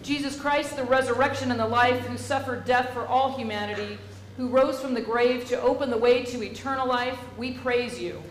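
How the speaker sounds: slow, loud, solemn intoning; solemn liturgy. Acted. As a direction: proclaim each phrase slowly to the congregation. Leads funeral liturgy.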